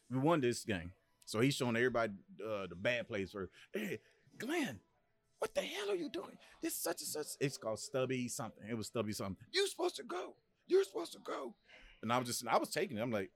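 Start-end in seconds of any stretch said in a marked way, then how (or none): 3.7-7.3 much higher mock coach tirade; angry coach. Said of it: "coach yelling in film". 9.5-11.5 much higher mock coach tirade; angry coach. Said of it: "coach chewing out".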